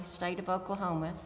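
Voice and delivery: ordinary talk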